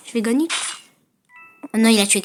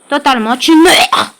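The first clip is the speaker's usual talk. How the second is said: taunting; 7 dB louder than usual. Acted. Taunting opponent in game.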